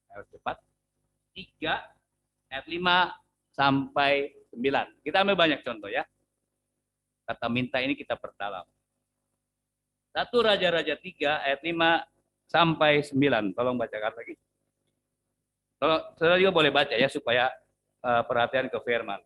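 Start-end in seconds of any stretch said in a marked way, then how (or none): none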